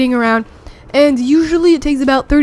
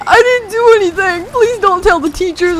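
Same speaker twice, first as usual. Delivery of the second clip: scared pleading; 6 semitones higher than usual. Retold or imitated. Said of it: "he looked like he was about to cry".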